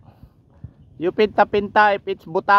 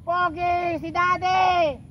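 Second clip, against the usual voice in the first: high call across the valley; 11 semitones higher, slower than usual. Real, not acted. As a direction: call out to the mountains. Shouting to the mountain echo.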